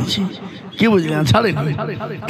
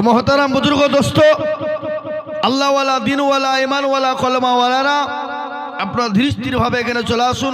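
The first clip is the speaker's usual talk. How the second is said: fervent preaching; 5 semitones higher than usual. Acted. Preacher calling the faithful to come forward, in a raised fervent voice. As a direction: rouse the crowd like a preacher from the stage.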